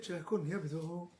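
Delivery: fast, soft, low blessing; quick blessing. Retold or imitated. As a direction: rattle the blessing off quietly. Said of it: "And God said".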